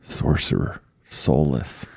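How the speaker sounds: low whispered spooky names. Pretend. Naming the sorcerer, in a spooky low whisper. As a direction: breathe it like a ghost story.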